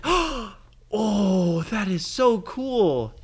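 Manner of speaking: high, slow, loud oh so cool; hammed-up childlike wonder; mock delight. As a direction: marvel at the glowing crystal. Crystal turns bright.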